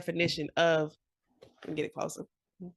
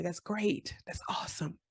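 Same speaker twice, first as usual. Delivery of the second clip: whispered aside; 8 dB quieter than usual. Acted. Sarcastic aside.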